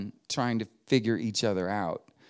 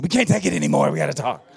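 bursting out; 2 semitones higher, 5 dB louder, much faster than usual. Retold or imitated. Americans at the dinner table breaking the silence, mock-desperate outburst. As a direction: burst out like you can't hold it. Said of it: "bust out and said".

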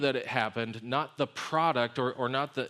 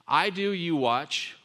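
"I do, you watch" spoken slowly, leaning hard on "do"; slow emphatic motto; 4 semitones higher, 5 dB louder, much slower than usual. Shown as a demonstration. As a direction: spell out the motto, stressing "do". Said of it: "summed up the, the discipleship way of Jesus like this".

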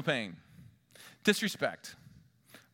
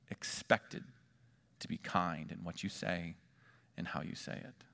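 hushed; 7 semitones lower, 6 dB quieter than usual. Real, not acted. Preacher drops to a near whisper.

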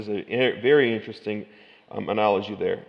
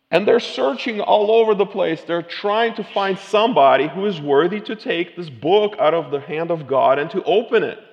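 fervent preaching; 9 semitones higher, 9 dB louder than usual. Acted. Preaching at full force.

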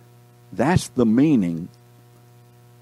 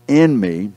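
"in" set apart and louder; stress on IN; 6 dB louder than usual. Real, not acted. Preacher reading verse to class, stressing "in". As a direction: hit "in", ease off on "me". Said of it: "The emphasis is being in".

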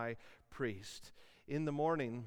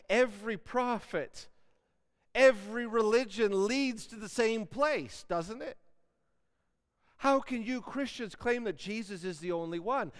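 higher, louder, a lightly voiced doubting challenger; skeptic objector; 5 semitones higher, 5 dB louder than usual. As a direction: play the sceptic pushing back, a touch mocking.

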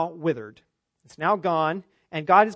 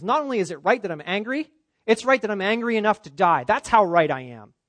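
voicing Jonah, louder and rising, self-righteous; indignant Jonah; 4 semitones higher, 6 dB louder than usual. Preacher voicing Jonah's stubborn defiance of God, louder and indignant. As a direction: let Jonah's stubborn anger build to a loud peak.

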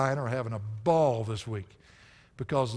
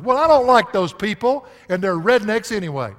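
scornful complaint; 6 semitones higher, 11 dB louder than usual. Retold or imitated. Voicing a grumbling rebel.

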